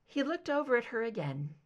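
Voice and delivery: ordinary delivery, nothing marked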